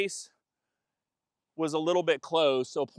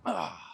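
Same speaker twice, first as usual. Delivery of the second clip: short grunt on the throw; effort grunt; 3 semitones higher, 7 dB quieter than usual. Real, not acted. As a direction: grunt as you throw the ball. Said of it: "Sorry for the grunting".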